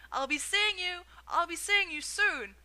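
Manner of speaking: high, loud sing-song call; joyful calling. A narrator's reading. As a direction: sing it out gleefully. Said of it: "sang out".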